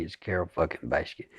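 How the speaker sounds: low, soft, quick "Carole fucking Baskin"; dropped, offstage Joe. Shown as a demonstration. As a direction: let it sink back into the throat. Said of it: "lost his performative thing".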